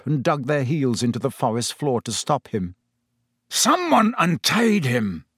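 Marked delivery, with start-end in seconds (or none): 3.5-5.2 higher, drawn-out angry snarl. A narrator's reading. Executioner accuses someone of freeing the beast, snarling. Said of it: "the executioner was snarling".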